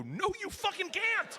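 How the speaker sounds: high, drawn-out outburst; exasperated dad. Retold or imitated. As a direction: blow up at your kid, pitch leaping up. Dad loses temper with daughter.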